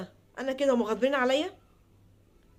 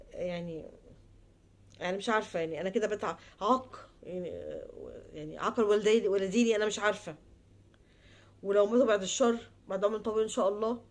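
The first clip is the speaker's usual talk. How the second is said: soft, broken, near tears; tearful despair; 5 semitones lower, 6 dB quieter than usual. Real, not acted. Daughter rejected by her parents, soft tearful murmur. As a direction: let the words sink into quiet grief.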